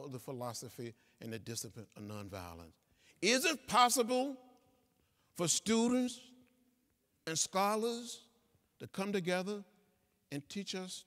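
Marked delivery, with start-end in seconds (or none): 3.2-11.0 higher, lifted plea; rising appeal. Real, not acted. Speaker appealing to students and scholars, voice lifted high. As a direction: reach up to the crowd with the question.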